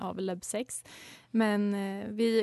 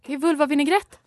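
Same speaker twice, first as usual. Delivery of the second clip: rising, louder punchline; excited exclaim; 5 semitones higher, 5 dB louder, faster than usual. Real, not acted. Host revealing her pun to co-hosts, excited. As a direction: announce it with glee.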